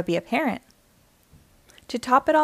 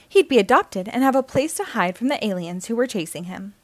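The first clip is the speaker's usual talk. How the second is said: ordinary delivery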